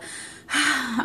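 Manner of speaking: big acted sigh of relief; relieved sigh. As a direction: let out a long relieved breath. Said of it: "like, let out a".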